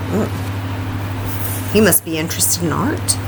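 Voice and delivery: ordinary talk, nothing marked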